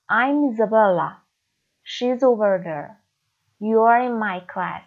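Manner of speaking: slow, loud, with the words linked: "I'm Isabella"; slow teaching demo, every word linked to the next; linked sounds. Shown as a demonstration. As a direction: run "over there" together smoothly. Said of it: "به این شکل".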